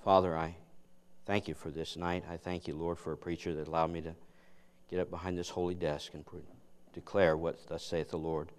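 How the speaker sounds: soft, hushed pulpit prayer. Acted. Preacher praying softly over his sermon before the congregation. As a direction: pray quietly and earnestly before preaching.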